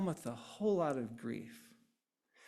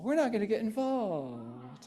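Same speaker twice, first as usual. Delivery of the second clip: mock neutral refusal; 4 semitones higher than usual. Speaker playing neutral Switzerland for the audience, mock hands-off refusal. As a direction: beg off, stretching out the final word.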